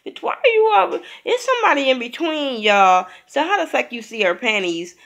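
high, loud sassy yell at the cast member; mock outraged yell. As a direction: scream it like you can't believe her nerve. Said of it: "I'm like".

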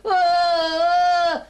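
high, loud drawn-out cry; loud acted scream; frog wail. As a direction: wail long like someone spun around. Ernie screams in the machine.

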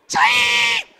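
high, loud cry of Ching; whooping sound effect shout; excited shout. Real, not acted. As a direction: yell the sound of the kick, way up high. Cheering the kick in the trick.

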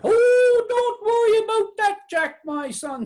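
high, crooning witch voice starting on a loud, drawn-out "Oh"; reassuring witch. A narrator's reading. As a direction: soothe Jack in a high witch's voice. Witch reassuring Jack in the tale.

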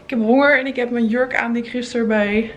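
ordinary delivery

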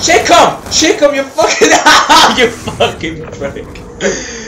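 high, loud shouting that cracks into laughter; laughing yell. Real, not acted. Gamer curses his opponent, laughing yell. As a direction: yell the insult through a laugh.

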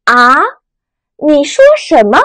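啊 rising like a puzzled question. Shown as a demonstration. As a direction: ask 啊 with a rise, not catching it. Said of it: "疑问的语气". (啊，第二声，疑问语气)

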